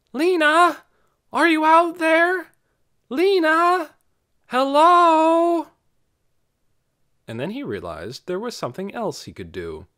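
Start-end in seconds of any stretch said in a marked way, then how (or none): 0.1-5.7 calling out across hills at full voice; high loud shouts into the dark; shouting. A narrator's reading. Dune calling for Lena across the hills, shouting. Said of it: "He stood up and called again".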